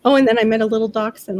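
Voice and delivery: ordinary talk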